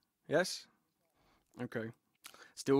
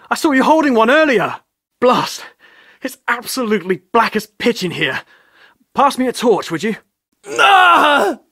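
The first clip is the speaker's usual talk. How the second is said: loud, brisk, commanding explorer voice, much louder; bold adventurer; 4 semitones higher, 14 dB louder than usual. Acted. Explorer demanding a torch in the dark tomb, loud and bossy. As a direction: push forward like a daring explorer barking orders.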